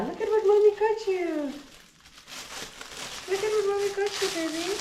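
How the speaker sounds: high, fast cooing pet voice. Real, not acted. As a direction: gush at the puppy. Cooing to her dog.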